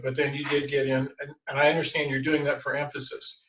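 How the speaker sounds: ordinary talk, nothing marked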